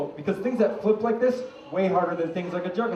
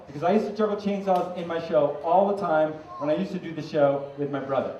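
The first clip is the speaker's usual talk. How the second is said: ordinary delivery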